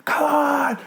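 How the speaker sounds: very high, strained screamed urging; imitated coach scream. Weight coach at a volleyball match, imitated high scream. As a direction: mimic a weight coach screaming in someone's face. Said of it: "how they scream in your face".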